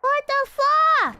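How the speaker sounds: high, loud, drawn-out exclamation; baffled outcry. Real, not acted. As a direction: stretch it out high and loud in disbelief. Exclaims at the confusing game.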